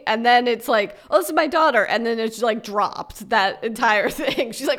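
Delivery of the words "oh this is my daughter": mock introduction. Petrovsky introducing his daughter.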